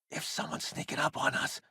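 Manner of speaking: hushed whisper. Acted. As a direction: whisper so nobody hears. Fearing an ambush.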